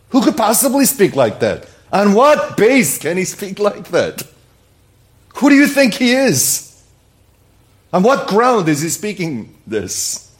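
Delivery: high, loud incredulous questioning; indignant challenge. Acted. Preacher voicing challenge to Jesus' authority, indignant and loud. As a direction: demand it as if scandalized. Acting out listeners' outraged challenge.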